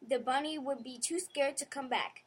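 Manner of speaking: usual speaking voice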